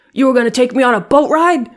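furious mob boss yell, high and loud; mob guy voice. Retold or imitated. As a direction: spit it out like an offended gangster. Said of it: "when I do the voice".

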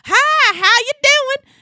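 high, hollered Texan greeting; loud and boisterous. Retold or imitated. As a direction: imitate a booming Texan greeting a bartender. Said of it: "he's just very loud and boisterous".

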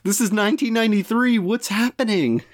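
high-pitched, disbelieving exclamation; what-on-earth disbelief, pitched high; incredulous outburst. Real, not acted. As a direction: react in baffled disbelief, voice jumping way up. Incredulous reaction to offensive headline.

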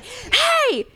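sharp high call; shout. Retold or imitated. To the old man yelling at a teen, a shout. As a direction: call out to stop him. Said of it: "I was like".

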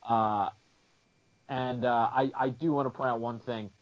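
normal talking voice

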